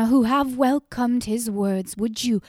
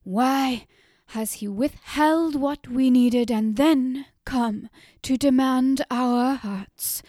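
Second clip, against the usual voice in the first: louder, higher accusation against the god; furious railing; 4 semitones higher, 7 dB louder than usual. A narrator's reading. Mother alone with the god, railing loudly at him. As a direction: hurl each question upward in rage. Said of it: "wielded her body like a whip".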